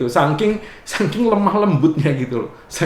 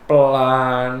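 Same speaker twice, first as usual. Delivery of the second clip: lightly mimicked slow, lower Javanese pace; mock slow drawl; 8 semitones lower, much slower than usual. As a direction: stretch out pelan pelan teasingly. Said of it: "Orang Batak ngelihat orang Jawa".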